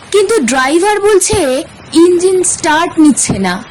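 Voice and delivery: ordinary delivery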